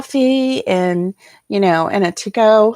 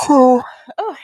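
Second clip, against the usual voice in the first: yawning; 7 semitones higher, 6 dB louder than usual. Real, not acted. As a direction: yawn breaking through. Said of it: "please pardon me".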